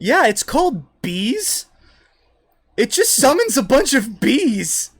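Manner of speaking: high, loud and slow; drawn-out, high-pitched character voice; wizard woman. Acted. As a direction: proudly present a spell in a high voice. Wizard woman describes her spell.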